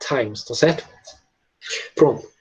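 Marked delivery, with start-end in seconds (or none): 0.1-0.4 "times" with an aspirated, airy T; blown T. Shown as a demonstration. Teacher explaining the sound of "times", blown T. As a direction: puff air out on the T of "times". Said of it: "Você tá meio que soprando".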